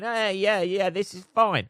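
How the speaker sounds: high-pitched put-on agreeableness; mock compliance. Owners handing over the dog as kids cry, high mock compliance. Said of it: "they're going".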